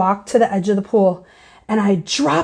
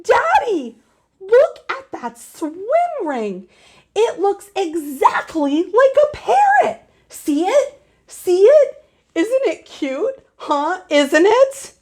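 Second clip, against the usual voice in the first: put-on high, gushing little-girl excitement; gushing to parents about a toy; gushy child; 7 semitones higher than usual. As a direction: squeal over the cute swim ring. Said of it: "heard me being gushy".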